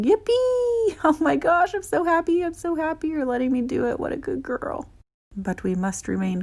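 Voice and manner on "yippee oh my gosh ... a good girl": high, squealing yippee with a laugh; inner squeal of joy. Pretend. Groomer's silent cheer for the dog, high giddy squeal. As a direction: squeal with delight you're hiding inside. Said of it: "inside I'm going".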